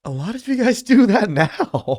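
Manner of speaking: loud, high, laughing jab; amused mockery. Real, not acted. Host reacts to talk of screaming, loud laughing mockery. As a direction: blurt it out high through a laugh.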